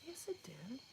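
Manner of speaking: unvoiced whisper insisting. Real, not acted. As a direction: whisper back the denial. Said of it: "It did not really happen".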